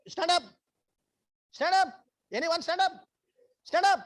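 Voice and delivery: high, loud repeated urging; calling out. Real, not acted. Facilitator urging someone in audience to stand, calling out high and loud. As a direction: call them up from the floor.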